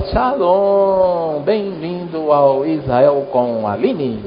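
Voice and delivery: slow, drawn-out presenter's welcome; Aline's show greeting. Retold or imitated. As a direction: imitate a host stretching out her opening greeting. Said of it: "Ela fala". (imitando saudação arrastada de apresentadora)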